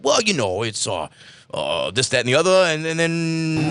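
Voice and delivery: Joe voice. Retold or imitated. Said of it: "Joe, what do you think".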